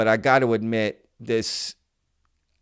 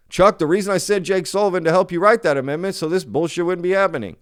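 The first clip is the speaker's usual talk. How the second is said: lightly acted, higher, louder and quick; mock phone-call quote; 8 semitones higher, 5 dB louder, faster than usual. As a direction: rattle off a scripted line as mock dialogue. Mock reading of leaked phone quote.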